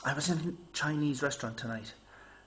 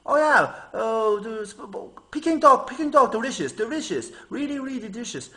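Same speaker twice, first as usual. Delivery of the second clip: much higher, much louder hammed-up sales pitch; pushy waiter; 8 semitones higher, 9 dB louder than usual. Waiter pushing the expensive duck, hammed-up and loud. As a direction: oversell the duck like an eager waiter.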